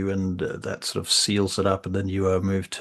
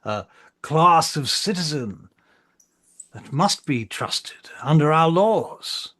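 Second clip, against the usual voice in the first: slow, higher-pitched emphatic oratory; indignant council marshal; 4 semitones higher, slower than usual. Acted. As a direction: defend your own people in stately phrases.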